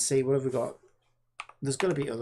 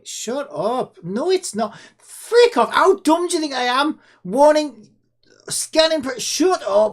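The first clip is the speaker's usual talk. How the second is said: shrieking at the screen, much higher and louder; outraged yelling; 10 semitones higher, 10 dB louder, slower than usual. Real, not acted. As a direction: blast the scam popup with a furious squeal. Yelling at scam website popups.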